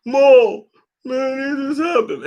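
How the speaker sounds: a hammed-up high whining excuse; mocking Jamel. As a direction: shrug it off like a scared kid. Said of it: "Every time he gotta go".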